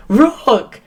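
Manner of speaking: a loud high cheer of the name; retold shout. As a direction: friends greeting her across the pub, loud cheer. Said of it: "they shouted".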